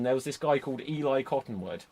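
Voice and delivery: ordinary talk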